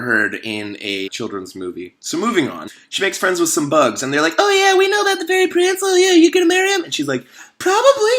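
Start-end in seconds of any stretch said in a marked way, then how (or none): 4.4-6.8 very high, fast, chattering bug voices; high, fast bugs chattering to the girl; squeaky bugs. Acted. 7.6-8.1 very high, breezy answer; high girl answering the bugs; dreamy girl. Acted. Girl voiced in the retelling.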